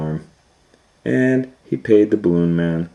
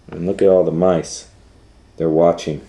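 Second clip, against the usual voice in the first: ordinary delivery, nothing marked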